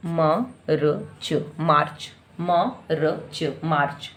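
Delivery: म, र, च said apart, then मार्च joined; soft sounding-out demo; separate sounds. Shown as a demonstration. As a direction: split मार्च into its sounds quietly. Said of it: "अलग अलग".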